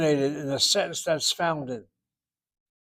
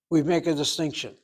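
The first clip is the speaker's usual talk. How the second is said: ordinary talk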